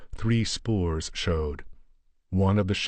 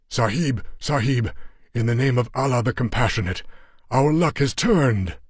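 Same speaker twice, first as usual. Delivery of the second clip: higher, louder, bursting with hope; excited Afghan companion; 4 semitones higher, 4 dB louder than usual. Acted. Yar Ali tells Steve their luck turned, excitedly.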